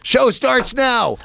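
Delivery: high, slow announcer call; Joe Rogan. Acted. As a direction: draw it out like a show opener. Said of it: "then have Joe Rogan go".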